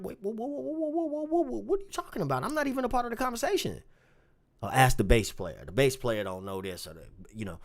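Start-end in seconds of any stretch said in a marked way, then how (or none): 0.0-3.8 high, fast, rattled whoas; shocked protest. Retold or imitated. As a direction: tumble out the words in disbelief. Shocked at being blamed.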